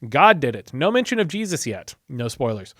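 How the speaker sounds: normal talking voice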